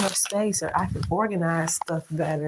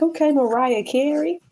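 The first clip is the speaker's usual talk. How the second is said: teasing; 8 semitones higher, 4 dB louder than usual. Retold or imitated. Teasing the screaming baby in retelling.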